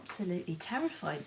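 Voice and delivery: ordinary delivery, nothing marked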